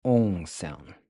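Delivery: nasal "ong" with the vowel through the nose. Shown as a demonstration. Teacher showing students the nasal "ong" sound. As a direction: let "ong" ring in the nose, falling. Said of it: "is pronounced with this nasal".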